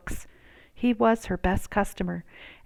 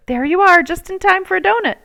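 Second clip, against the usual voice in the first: higher, quick and jolly; grocer's voice; 11 semitones higher, 7 dB louder, faster than usual. Acted. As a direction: offer the donut with a cheerful lilt. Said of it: "the grocer called".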